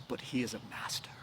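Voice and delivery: hushed, quick whisper of dread; fearful whisper. Acted. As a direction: whisper it as if afraid to say it. Inigo fears the six-fingered man.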